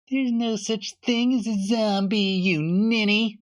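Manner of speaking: put-on a schoolyard bully sneering at a smaller kid; scornful bully. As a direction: talk down to him like a bully. Bully mocking Johnny.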